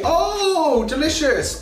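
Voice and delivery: long, high-pitched "oh" of delight; high, theatrical food rapture; delighted relish. Acted. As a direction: gush over a favourite treat. Delighted at the real sushi.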